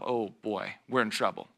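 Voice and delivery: a quiet dismayed aside to yourself; worried inner thought. Pretend. Follower watching guide hit a tree, quiet dismay. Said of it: "you're thinking to yourself".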